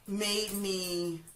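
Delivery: ordinary talk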